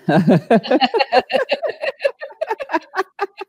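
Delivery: high scolding laugh; high-pitched mock-argument laughing; argument laughter. Shown as a demonstration. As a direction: laugh as if telling someone off. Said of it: "wag your finger and laugh".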